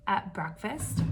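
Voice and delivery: usual speaking voice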